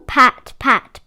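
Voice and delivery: plain reading voice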